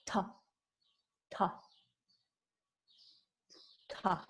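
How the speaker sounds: "ta" repeated with long gaps; isolated retroflex "ta" syllables; tongue on palate. Shown as a demonstration. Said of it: "the tongue is on the palate".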